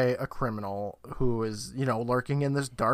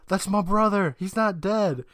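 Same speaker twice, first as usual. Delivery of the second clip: higher-pitched retold call; 6 semitones higher than usual. Jamie pleading for the brother at the doorway, calling out. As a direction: call it out, mildly raised. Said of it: "And Jamie calls out".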